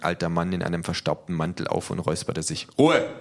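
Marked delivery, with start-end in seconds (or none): 2.8-3.1 high, loud call for silence; shouted order. A narrator's reading. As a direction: call the crowd sharply to order. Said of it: "rief der hohe Beamte". (laut gerufener Befehl)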